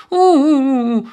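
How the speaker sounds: high-pitched wailing "woo-hoo"; acted wailing sob. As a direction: bawl like a bereaved husband. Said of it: "却当众痛哭".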